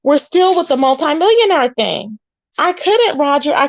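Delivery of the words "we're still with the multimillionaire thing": exasperated disbelief. Retold or imitated. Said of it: "I said".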